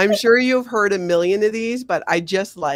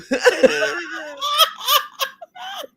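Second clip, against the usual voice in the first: long high laughing fit; real pealing giggles, pitched up; helpless laughing. Real, not acted. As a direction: laugh hard at your own wordplay. Laughs at Big Blue joke.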